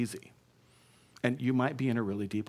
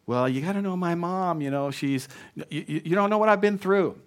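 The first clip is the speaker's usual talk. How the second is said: higher-pitched whiny excuse-maker; excuse-making; 5 semitones higher than usual. Acted. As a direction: protest your excuses, pitch raised.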